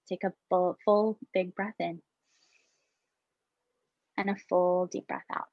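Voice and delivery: soft, calm breathing cues with long pauses; guided meditation. Acted. Facilitator guiding teachers through breaths, soft meditation voice. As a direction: lead the breath in and out gently.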